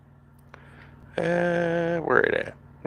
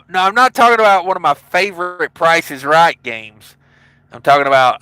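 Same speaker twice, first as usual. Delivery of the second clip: ordinary talk, nothing marked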